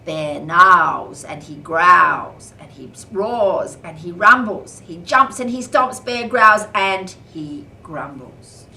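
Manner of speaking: loud slow growling read. A narrator's reading. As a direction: growl the words like a bear. Bear growling, roaring.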